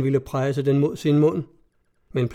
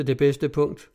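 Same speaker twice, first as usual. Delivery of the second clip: usual speaking voice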